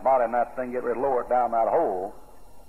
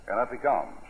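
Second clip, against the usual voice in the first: ordinary talk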